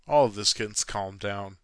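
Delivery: ordinary talk, nothing marked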